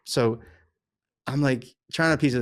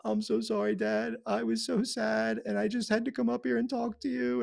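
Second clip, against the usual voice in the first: high-pitched imitation of an apologetic daughter; pleading teenage daughter; 8 semitones higher than usual. Daughter apologising to dad at night, imitated pleading. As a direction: put on a higher, sorry-sounding girl's voice. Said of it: "She was like".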